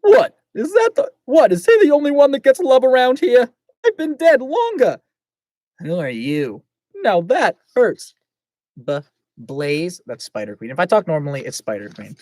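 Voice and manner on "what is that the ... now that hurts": high, loud Brooklyn tough guy; Brooklyn accent. Acted. Blaze complaining about being forgotten, Brooklyn accent. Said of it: "Blaze sounds like he's from Brooklyn".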